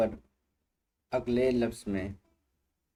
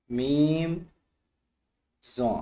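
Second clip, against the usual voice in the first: a loud, higher letter call for students to repeat; loud drill call; 3 semitones higher, 4 dB louder, much slower than usual. Shown as a demonstration. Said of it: "ज़ोर से बोलें". (ज़ोर से, ऊँची आवाज़ में)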